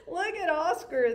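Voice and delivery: mocking call through a laugh; laughing taunt. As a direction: laugh at him as you point. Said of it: "they cried".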